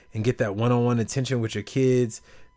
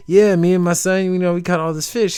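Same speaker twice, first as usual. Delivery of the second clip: higher, louder and proud; proud fisherman; 7 semitones higher, 6 dB louder than usual. Retold or imitated. Fisherman telling the tourist about his catch, proud. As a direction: boast gently about the catch. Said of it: "He was like".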